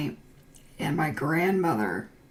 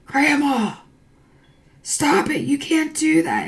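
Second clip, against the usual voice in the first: loud, high family protest at grandma; pleading shout; 10 semitones higher, 8 dB louder than usual. Retold or imitated. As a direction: call out to stop her, raised and pleading. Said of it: "and we're all like".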